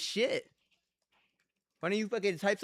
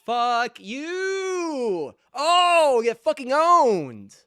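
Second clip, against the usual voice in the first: screaming in victory, much louder and higher; triumphant taunting yell; 8 semitones higher, 13 dB louder, much slower than usual. Real, not acted. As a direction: bellow the gloat at the opponent, slowly drawn out. Gamer taunting opponent after win.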